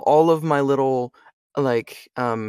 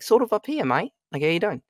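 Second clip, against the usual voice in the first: higher-pitched Australian greeting; Australian accent; 5 semitones higher, faster than usual. Shown as a demonstration. Voice actor showing his warm-up for Australian, Australian accent. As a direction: slip into a casual Australian accent. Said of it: "Australian's like".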